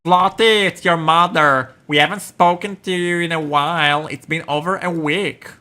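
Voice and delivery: high, loud, fussy mother; mom on voicemail. Acted. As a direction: talk like a worried mom.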